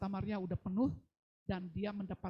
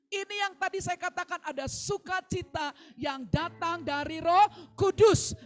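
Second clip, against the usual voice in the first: fiery preaching; 7 semitones higher, 8 dB louder than usual. Real, not acted. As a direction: lift the church with joy, full voice. Preaching climax.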